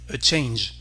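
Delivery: ordinary delivery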